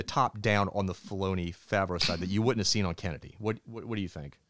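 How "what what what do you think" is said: ordinary talk, nothing marked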